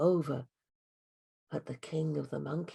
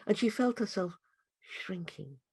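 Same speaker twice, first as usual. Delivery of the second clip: plain reading voice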